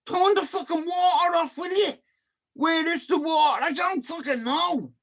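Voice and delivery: high-pitched exasperated yelling; acted frantic shouting. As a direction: re-enact a panicky squabble. Re-enacts panicked quarrel over leaking water.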